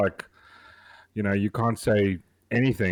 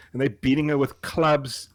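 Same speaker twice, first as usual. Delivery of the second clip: ordinary talk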